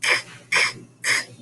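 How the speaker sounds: K, k, k breathed out with no voice; k sound. Shown as a demonstration. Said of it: "C for cut".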